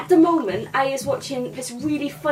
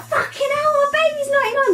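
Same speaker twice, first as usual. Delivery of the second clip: very high-pitched horrified cry; mock shock; 14 semitones higher, 4 dB louder than usual. Imitating celebrity parents of an aged baby, mock shocked. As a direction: shriek it like a stunned parent. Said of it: "well shocked".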